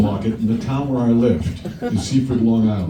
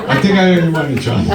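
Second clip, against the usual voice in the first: laughing confession; 9 semitones higher, 4 dB louder, faster than usual. Real, not acted.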